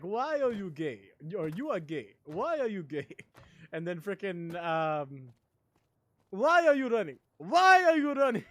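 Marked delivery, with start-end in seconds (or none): 0.0-3.1 lightly mimicked Nigerian accent; Nigerian accent impression. Streamer remembering old memes, imitated Nigerian accent. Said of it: "With that accent". 6.3-8.4 high, shouted mock Nigerian accent; Nigerian accent impression. Streamer remembering old memes, shouted Nigerian accent. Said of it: "With that accent".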